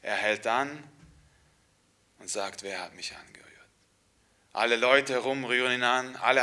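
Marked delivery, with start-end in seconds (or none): 2.6-3.5 hushed question. Acted. Jesus asks softly in story.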